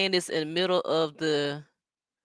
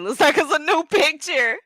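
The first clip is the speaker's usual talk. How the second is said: mocking quote; 9 semitones higher, 6 dB louder than usual. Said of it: "Cashmere said".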